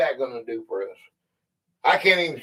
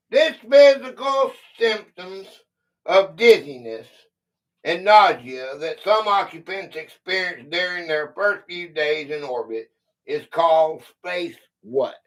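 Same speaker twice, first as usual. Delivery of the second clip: reading a quiz clue; 5 dB louder than usual. Acted. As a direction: clear read-aloud quiz voice. Reads a quiz clue aloud.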